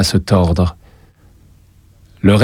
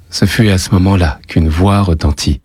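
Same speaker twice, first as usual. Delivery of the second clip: ordinary delivery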